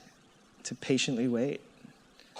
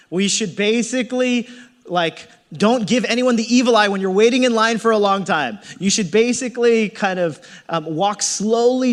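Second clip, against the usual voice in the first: mock preachy lecture; 6 semitones higher, 8 dB louder than usual. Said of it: "basically say".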